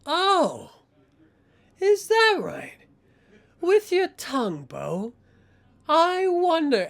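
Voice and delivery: high, drawn-out taunting questions; sinister enforcer feigning surprise; mock-surprised menace. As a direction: toy with him, pitched high. Cobra mockingly threatens Bo.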